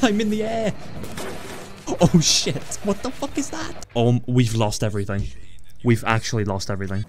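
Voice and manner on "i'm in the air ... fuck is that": high yelps breaking into laughter; startled panic. Real, not acted. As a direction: yelp high as if flung into the air. Car thrown into the air.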